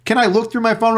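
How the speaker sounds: high mock whine; high mock plea. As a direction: plead like a cornered suspect. Suspect voiced in retold story.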